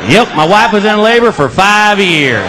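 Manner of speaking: loud, slow, laughing sarcasm; sarcastic reply. Retold or imitated. Husband answers the cashier, loud laughing sarcasm. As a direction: bark the absurd answer through laughter.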